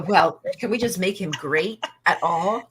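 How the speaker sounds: normal talking voice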